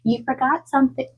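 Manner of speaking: soft, close-to-the-ear delivery; creepy murmur. Retold or imitated. Man creeping up behind two friends, creepy murmur. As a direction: lean in and say it quietly. Imitates man leaning into their ears.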